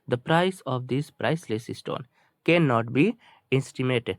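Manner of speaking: ordinary delivery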